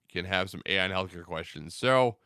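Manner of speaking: ordinary talk, nothing marked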